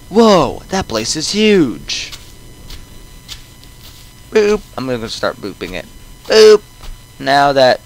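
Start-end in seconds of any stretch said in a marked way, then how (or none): 0.1-2.1 amazed. Acted. Guest first sees the mansion, amazed. 4.3-4.6 short, high sung-out boop; playful boop. Pretend. Player boops at the game, playful high beep. Player makes a booping noise. 6.2-6.6 loud, very high boop; playful boop. Pretend. Player boops at the game, loud high beep. Player makes a booping noise.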